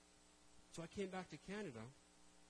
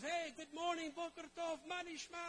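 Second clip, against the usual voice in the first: excited greeting; 11 semitones higher than usual. Retold or imitated. Tour guide bursting to show Israel, excited. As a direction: greet him like an overeager host.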